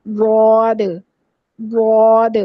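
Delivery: واد said firmly, side of the tongue against the upper molars; model ض sound. Shown as a demonstration. Teacher modelling the letter ض for her students. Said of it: "exact آواز نکلے گی واد".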